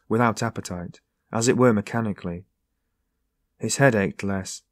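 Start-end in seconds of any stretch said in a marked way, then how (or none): none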